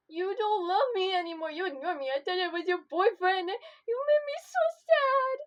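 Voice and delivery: loud, high hammed-up sobbing wail; mock sobbing. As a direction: wail as if heartbroken over nothing. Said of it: "start sobbing and crying".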